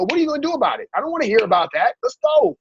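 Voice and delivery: high, punchy tough-love push; rally them to get going; pushy motivating friend. Retold or imitated. Tough-love tribe member pushing you.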